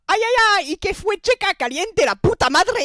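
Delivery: very high, loud Mexican caricature; hammed-up Mexican Spanish; Mexican accent. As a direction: yelp it like a cartoon Mexican. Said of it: "l'accent mexicain".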